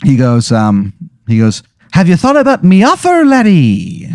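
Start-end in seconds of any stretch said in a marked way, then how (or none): 1.9-4.1 very high, slow Irish leprechaun; sly squeaky leprechaun tempting the lad. Acted. Leprechaun makes his offer.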